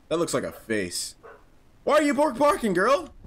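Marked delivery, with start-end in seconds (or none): 1.8-3.1 pitched-up talk to a dog; higher dog talk. Pretend. Asking his dog why it's barking, in higher dog talk. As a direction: coax the dog, voice raised.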